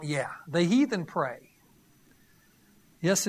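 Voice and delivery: ordinary delivery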